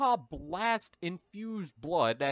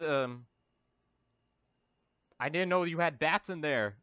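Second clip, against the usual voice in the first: ordinary delivery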